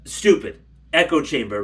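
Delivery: usual speaking voice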